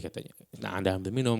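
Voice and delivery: ordinary delivery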